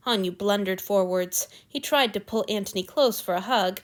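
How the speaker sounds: ordinary delivery, nothing marked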